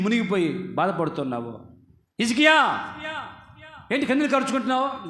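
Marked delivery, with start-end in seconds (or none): 2.2-5.1 calling out. Acted. God calling to sick Hezekiah, raised calling voice. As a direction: hail him by name, then ask quickly.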